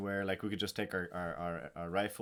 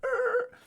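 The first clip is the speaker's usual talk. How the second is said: startled grunt; 4 dB louder than usual. Retold or imitated. Sergeant major stops, startled.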